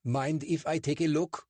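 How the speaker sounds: a suspicious bobby lecturing two suspects; portly constable, stern and clipped; English constable. Acted. Constable questions.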